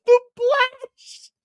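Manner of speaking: high, loud laughing mock of the Blash; mocking mispronunciation. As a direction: squeal the Blash through laughter. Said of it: "W D said the Blash".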